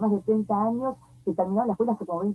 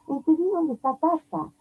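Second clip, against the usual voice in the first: mildly voiced girl sobbing out her discovery; tearful realization; 8 semitones higher than usual. Mother retelling her daughter tearfully grasping where grandpa is. Daughter crying finally understands death.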